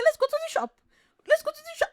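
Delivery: very high, childish mock whine; whiny beggar imitation. Vlogger mocks a beggar nagging to go shopping, very high whine. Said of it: "They say".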